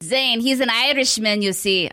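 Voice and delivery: hammed-up, awful Irish accent; jokey, badly faked Irish brogue. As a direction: attempt an Irish lilt and miss. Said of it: "I don't know what accent that was".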